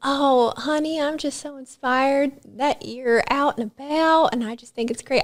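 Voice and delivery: high, slow Southern lady voice; sweet small-town Southern drawl; imitating a Southern granny; Southern accent. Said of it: "I'm giving them a Southern accent".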